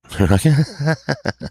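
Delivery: short laugh. Real, not acted. Laugh after audience laughed.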